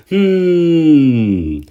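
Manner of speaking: long high loud knowing hmm; suspicious hum. Real, not acted. Vlogger doubting Garfield's motives, suspicious hum. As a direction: hum like you've caught him out.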